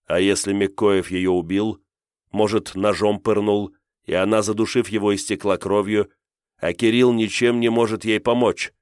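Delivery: usual speaking voice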